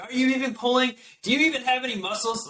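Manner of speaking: high-pitched mocking imitation of a scornful nurse; put-on high, demeaning voice; mean nurse. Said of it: "She's just been so mean to me".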